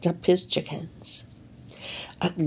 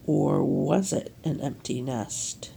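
1 hushed mysterious question; 6 semitones lower, 7 dB quieter than usual. A narrator's reading.

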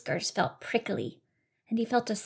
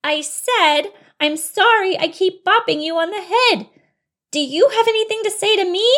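high, loud, insistent; exasperated apology; 11 semitones higher, 9 dB louder than usual. Acted. Bunny repeating ignored apology to mouse, exasperated loud voice. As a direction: nearly yell the apology, demanding an answer.

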